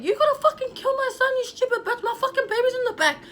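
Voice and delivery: high-pitched yelled insults at the driver; furious mother yelling at a reckless driver, replayed; retold yelling. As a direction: scream it up high out the window. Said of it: "I was like".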